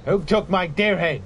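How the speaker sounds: loud demanding call; yelling across the room. Real, not acted. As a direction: shout it out to everyone. Repeated calling out.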